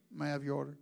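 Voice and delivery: low, soft drive-thru voice, muffled; mock drive-thru worker, low and soft; drive-thru greeting. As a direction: do the drive-thru speaker box. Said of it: "they go".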